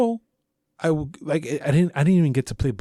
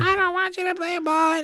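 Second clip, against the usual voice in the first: put-on high squeaky old-lady voice, much higher; mocking the neighbor lady. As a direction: mimic the cranky neighbor in a shrill-pitched squeak. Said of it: "She would come out and be like".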